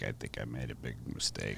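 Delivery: imitating his thought. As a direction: voice the look on his face as a hushed inner thought. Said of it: "had this look on his face like".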